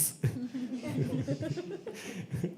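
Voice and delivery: long high-pitched laugh. Real, not acted. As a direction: crack up at your own joke. Laughs at own joke.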